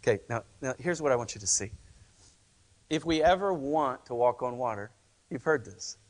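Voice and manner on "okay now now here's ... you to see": ordinary talk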